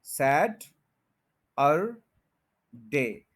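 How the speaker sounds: सैट, अर, डे split by pauses; syllable by syllable. Shown as a demonstration. Teacher splitting Saturday for learners, syllable by syllable. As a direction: break Saturday into three separate syllables. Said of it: "सैट के ऊपर stress है".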